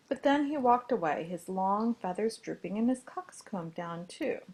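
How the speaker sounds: ordinary delivery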